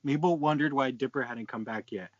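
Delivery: ordinary talk, nothing marked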